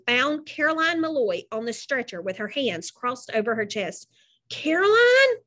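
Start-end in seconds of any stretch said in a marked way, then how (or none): 4.5-5.4 high, rising shocked cry of a name; shocked gasp. A narrator's reading. Wally finding Caroline on the stretcher, gasping. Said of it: "Wally gasped".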